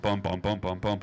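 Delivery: ordinary talk, nothing marked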